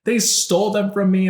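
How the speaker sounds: high-pitched imitation of outraged crying; mock enraged wailing. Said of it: "so mad, like, so enraged".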